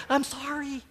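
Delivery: very high, pleading squeak of sorry; helpless mock apology. Husband who can't stop apologizing to his wife, mock pleading. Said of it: "I'm like".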